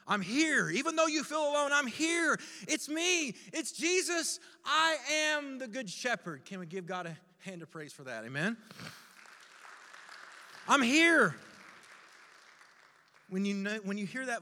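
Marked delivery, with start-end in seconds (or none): 0.1-6.5 raised, forceful proclamation; emphatic reassurance. Retold or imitated. Good Shepherd revealing himself as Jesus, emphatic. 10.7-11.3 high, loud shout; booming reassurance. Retold or imitated. Preacher voicing the Shepherd's presence, shouted. Shepherd's call repeated at full volume.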